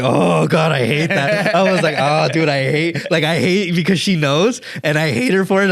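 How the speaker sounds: a hint of mock agony at hearing the truth; exasperated groan. Man complaining about his girlfriend's blunt truth, half-joking whine.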